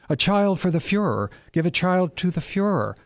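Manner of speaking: high, exultant cry of triumph; rejoicing. A narrator's reading. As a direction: lift the voice in gleeful triumph. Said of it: "he rejoiced to himself".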